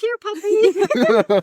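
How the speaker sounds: slow, high coaxing call; calling a lost pet. Retold or imitated. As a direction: coax the dog out, slowly. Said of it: "he says".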